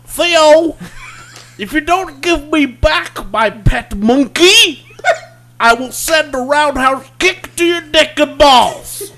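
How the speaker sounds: high, loud, slow yell with laughter; Bill Cosby impression. Host yells at the sitcom son in a dad voice. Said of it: "I've got Bill Cosby".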